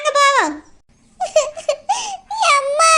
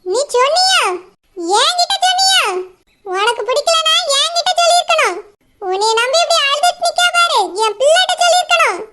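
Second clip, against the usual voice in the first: put-on angry older woman; 3 semitones higher than usual. Mother scolding the groom who ruined her daughter, furious and mocking. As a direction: tear into him like an outraged mother.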